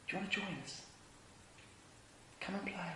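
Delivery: very soft, tender coaxing; gentle invitation. Acted. Jesus inviting people to join, hushed and gentle. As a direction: invite them in almost under the breath.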